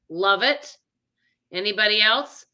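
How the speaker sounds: ordinary talk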